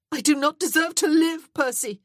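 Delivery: high, loud, anguished outburst; desperate cry. Acted. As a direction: cry out your guilt. Desperate self-condemnation to him.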